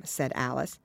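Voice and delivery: ordinary delivery, nothing marked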